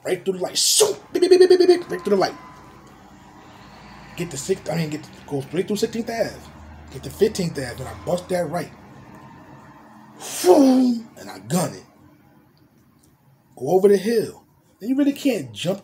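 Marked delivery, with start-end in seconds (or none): 0.6-1.9 high, loud rapid beeping; honking horn. Retold or imitated. Running the red light, loud horn honks. 10.2-11.1 whoosh. Retold or imitated. Car whooshing through.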